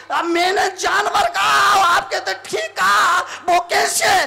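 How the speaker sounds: high, loud demand; hammed-up disbelief, stretched at the end; acted bafflement. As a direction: protest as the baffled wife. Baffled wife demands explanation.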